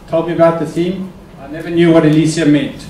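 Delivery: normal talking voice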